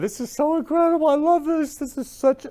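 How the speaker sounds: very high, loud, fast gush. Real, not acted. Host thrilled by mom's sweet photo story, gushing. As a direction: pour out excitement in a high voice.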